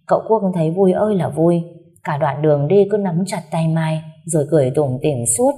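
usual speaking voice

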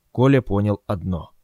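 plain reading voice

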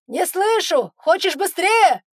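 high, loud teasing yell; shouting over wind. A narrator's reading. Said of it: "он кричит ещё громче".

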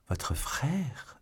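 startled, breathy gasp of disbelief; as if the secret knocks the wind out; shocked cry. A narrator's reading. Madame Bonacieux reacting to Milady's secret, stunned whisper-cry. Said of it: "s'écria madame Bonacieux".

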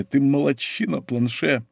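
mildly choked-up admiration; almost in tears. A narrator's reading. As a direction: praise him, quietly moved. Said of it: "воскликнул он почти со слезами".

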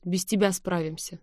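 ordinary delivery

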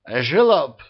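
put-on hiccup a drunken insult; drunken hiccup on the word. A narrator's reading. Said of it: "пьяно икнул Ара". (пьяно, с иканием)

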